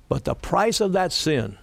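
ordinary talk

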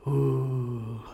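soft, low breathy ooh of awe; hushed ooh. Retold or imitated. Preacher mimicking tourists' soft ooh. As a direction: imitate the crowd's quiet wonder.

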